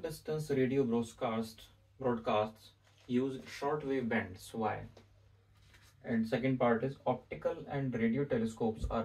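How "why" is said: ordinary talk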